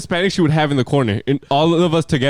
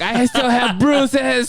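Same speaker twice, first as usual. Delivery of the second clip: high, drawn-out mock complaint; wailing teacher impression; 10 semitones higher, much slower than usual. Teacher still going on about her crash, high hammed-up whine. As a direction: stretch it out as a hammed-up aggrieved teacher.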